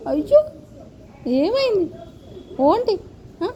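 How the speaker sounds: lilting high coaxing questions. Real, not acted. Mother asking her sulking baby what happened, high baby-talk coo.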